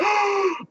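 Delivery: 높고 짧게 들이쉬는 놀란 숨소리; 흥분해서 숨을 확 들이켜기; excited gasp. Retold or imitated. Excited gasp at learning she pitched.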